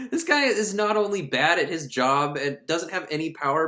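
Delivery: usual speaking voice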